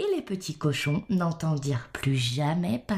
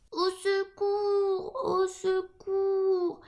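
very high, long wailing piglets; cry for help; 13 semitones higher, much slower than usual. A narrator's reading. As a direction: wail for help on a high, stretched note. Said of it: "crièrent les petits cochons". (petits cochons qui crient au secours, voix très aiguë)

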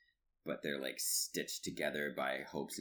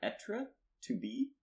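high, slow, unsure offering; mock-timid high-pitched answer; tentative answer; slower than usual. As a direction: offer it like a hopeful bluffing pupil. Acting out a feeble French answer.

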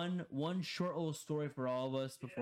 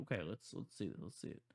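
very low, fading mutter; muttered self-talk; 9 semitones lower, 7 dB quieter, faster than usual. Retold or imitated. Player sizing up an opponent to himself, low fading mutter. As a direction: size it up under your breath. Retelling his thoughts while playing.

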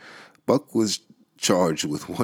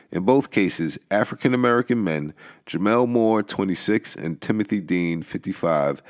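ordinary talk

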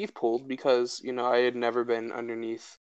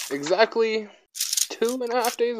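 mock announcer; 10 semitones higher than usual. Real, not acted. Dramatic high announcement.

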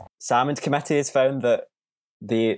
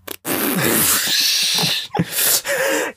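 high-pitched burst of laughter; laughing. Real, not acted. Co-hosts amused by the Georgia accent, high laughter.

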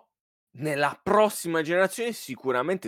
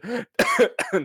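sudden choking cough; choking on saliva; 11 semitones higher than usual. Real, not acted. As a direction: cough hard on swallowed spit. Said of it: "mi è andato in traverso la saliva".

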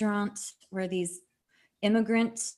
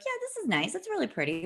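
high, polite, underwhelmed praise; mock unimpressed; 11 semitones higher, faster than usual. Tourist shrugging at the Georgia mountains, mock underwhelmed. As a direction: fake a bored compliment, pitched way up. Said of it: "You're just like".

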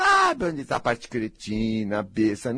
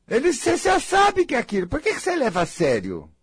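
exasperated; 14 semitones higher, faster than usual. Acted. Exasperated mock voice.

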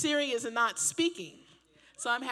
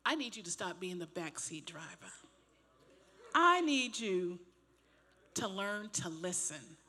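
gentle voice of the Lord; 4 semitones lower than usual. Retold or imitated. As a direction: speak as God gently correcting. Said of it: "the Lord just said to me".

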